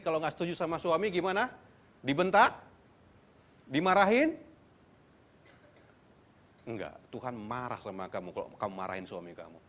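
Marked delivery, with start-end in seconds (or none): none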